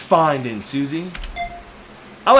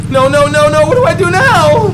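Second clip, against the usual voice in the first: high, loud panic; rising alarm, voice shooting up; panicked; 10 semitones higher, 8 dB louder than usual. Acted. Waitress panicking at a man waiting by the door, high and loud.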